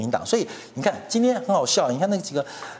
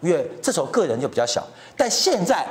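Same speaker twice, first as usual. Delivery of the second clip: ordinary talk, nothing marked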